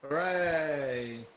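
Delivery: loud drawn-out cheer. Real, not acted. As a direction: let out a long hooray. Cheering in game chat.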